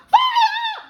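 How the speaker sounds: very high, loud scream; yell. Acted. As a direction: scream the word. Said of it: "the old woman yelled".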